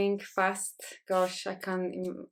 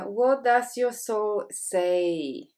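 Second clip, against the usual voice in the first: very slow, louder, drawn-out question; card reading; 5 dB louder, much slower than usual. Real, not acted. Host reading the picked card to the group, slow and projected. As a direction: read the card out slowly and loudly, stretching each word. Reads out the picked card's message.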